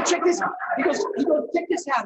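high, loud eager urging; excited call. Retold or imitated. Pierre eagerly shows Louis a trick, high and loud. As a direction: push him to look, high and loud.

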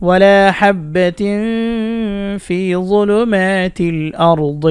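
slow, drawn-out tilawat; measured Quranic chant; Quran recitation. Acted. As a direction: chant it with long vowels. Recites verse fragment.

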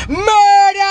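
outraged cry of murder; screaming murder. Real, not acted. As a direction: scream it out as if calling for help. Crying out in outrage.

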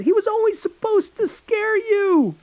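high, loud pleading excuse; boy shouting his excuse at his furious friend; desperate shouting. Acted. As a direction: shout the excuse over her.

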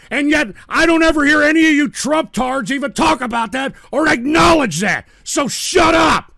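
high, loud rant building to a shout; angry rant. Real, not acted. Host ranting at Trump fans in chat, furious loud rant. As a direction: rail at the chat, then snap shut up.

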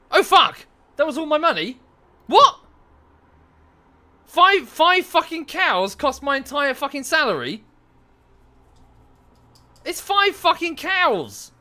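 loud, high swearing at the price; furious outburst. Real, not acted. Gamer furious at cattle costing his salary, loud swearing. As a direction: rage at the cost of five cows.

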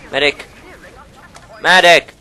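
loud call. Real, not acted. Gamer calls loudly for the medic. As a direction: call across the field.

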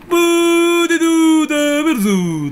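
high, loud, slow drawn-out shout; loud, high celebrity impression shout; Kusanagi impression. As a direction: stretch the words out in a loud high cry. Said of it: "ポテト食べるぞのモノマネ".

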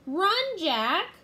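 high, loud warning yell; urgent cry of alarm; shouting. A narrator's reading. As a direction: shout for him to flee. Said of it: "shouted Annie".

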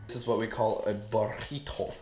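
ordinary talk

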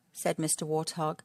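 usual speaking voice

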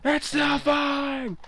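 high, slow yell; hammed-up disaster cry; panicked shout. Tower still collapsing.

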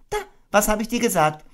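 high, quick told-you-so voice; fast motherly Kanga. Acted. Kanga scolding her soapy baby, mildly. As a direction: chide gently.

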